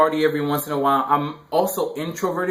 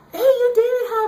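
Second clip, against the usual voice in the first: very high, louder mock girl voice; girly voice; 5 dB louder than usual. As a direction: squeal it like an eager teen girl. Girl's voice in retold chat.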